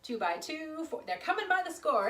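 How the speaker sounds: high, punchy boast; bragging. Shown as a demonstration. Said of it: "they were kind of bragging".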